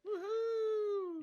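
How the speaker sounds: high excited woohoo; excited whoop. Real, not acted. Greeting Carolyn in chat.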